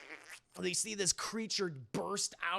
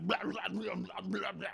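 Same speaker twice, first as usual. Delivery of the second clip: garbled "blah" convulsing noises; imitated sick-man gibbering; mock losing it; 2 semitones higher than usual. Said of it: "that guy's fucking losing it".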